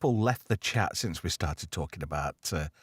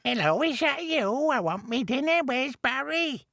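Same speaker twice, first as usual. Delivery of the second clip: put-on old granny voice. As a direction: play a doddery old granny on the phone. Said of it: "pretend to be Missus Shitter".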